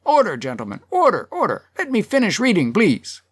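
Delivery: high, strained call over a din; chairman shouting. A narrator's reading. Chair hammers his gavel over the crowd, high calling. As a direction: plead for order, pitch jumping up. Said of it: "hammering with his gavel and shouting".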